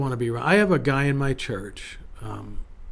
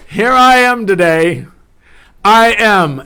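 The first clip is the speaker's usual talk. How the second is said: loud, high, ringing proclamation; rallying declaration; 6 semitones higher, 11 dB louder, slower than usual. Shown as a demonstration. Pastor models the declaration for listeners, rallying and loud. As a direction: proclaim it boldly for others to repeat. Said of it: "and state your age and say".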